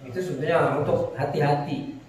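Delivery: normal talking voice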